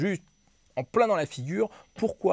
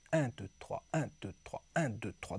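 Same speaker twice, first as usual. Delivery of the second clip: "Un, deux, trois" as a repeated falling triplet count; 3 semitones lower, 6 dB quieter than usual. Shown as a demonstration. Teacher showing how to think in triplets, rhythmic count. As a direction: count "Un, deux, trois" in even groups of three, leading on each "Un". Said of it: "tout penser en triolet".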